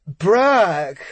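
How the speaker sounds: put-on loud, slurred drunken shout; drunk yell. A narrator's reading. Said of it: "yells into the phone with a slurred voice".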